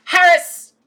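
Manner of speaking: loud, rising pompous roll call; grand name recital. Acted. Kitten recites his grand full name, pompous. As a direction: roll out every title proudly.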